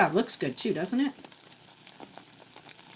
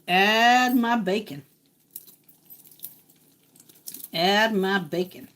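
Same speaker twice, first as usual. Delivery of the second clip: voice of the sizzle; 2 semitones lower, slower than usual. Pretend. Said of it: "That's the sound saying".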